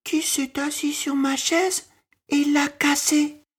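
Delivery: a tiny cub, upset and close to tears; Baby Bear about to cry. A narrator's reading. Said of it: "demanda Bébé Ours et il se mit à pleurer". (Bébé Ours, très aigu, au bord des larmes)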